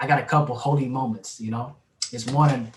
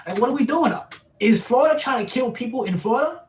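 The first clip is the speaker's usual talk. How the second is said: higher, incredulous complaint about the weather; exasperated; 9 semitones higher than usual. Real, not acted. Complaining to a friend about Florida weather, exasperated and high. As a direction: throw your hands up at the weather.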